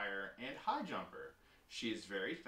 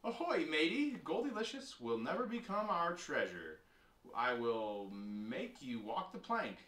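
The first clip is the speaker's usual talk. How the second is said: loud, high pirate hail slowing to a threat; play pirate; 4 semitones higher, 4 dB louder than usual. Acted. Brother threatens sister as pretend pirate, hammed-up.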